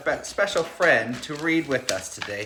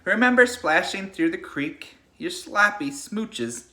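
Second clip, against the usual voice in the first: put-on reading to children. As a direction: lull the class like a bedtime story. Reads picture book to class.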